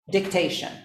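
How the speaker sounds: usual speaking voice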